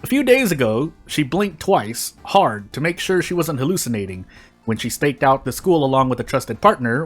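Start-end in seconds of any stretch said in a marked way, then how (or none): none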